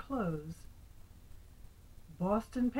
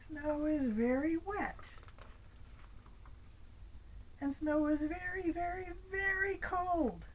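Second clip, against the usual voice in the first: mad kitty; 8 semitones higher than usual. Acted. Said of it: "He's really mad at the computer".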